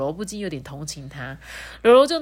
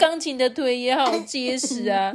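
crying hippo; 6 semitones higher than usual. Acted. Rourou takes a remark as an insult, wailing in hurt. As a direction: wail it out like a hurt crybaby. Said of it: "一直哭".

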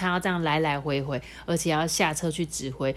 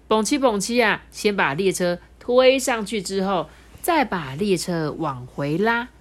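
usual speaking voice

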